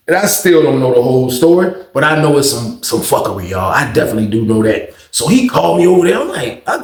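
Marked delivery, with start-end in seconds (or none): none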